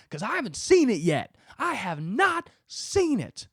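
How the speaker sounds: high, loud coach tearing into his player; tough coach. Pretend. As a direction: berate him like a drill sergeant coach. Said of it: "a guy hovering over me just being like".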